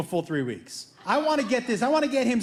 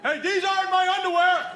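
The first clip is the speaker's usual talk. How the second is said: son's outraged discovery; 8 semitones higher than usual. Retold or imitated. Son yelling from his bedroom to dad, high outraged cry. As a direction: shout it like a kid who just found panties in his drawer.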